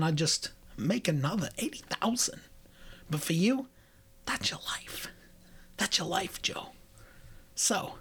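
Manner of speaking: hushed film monologue, halting with long pauses; soft, deliberate menace fading toward a whisper; Christopher Walken. Acted. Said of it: "Anyway, Christopher Walken".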